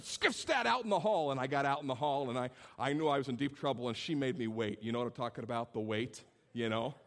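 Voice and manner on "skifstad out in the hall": higher and louder sharp order; furious teacher. Retold or imitated. Angry teacher ordering the student into the hall, loud and high. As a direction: bark the name, send him out. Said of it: "She said".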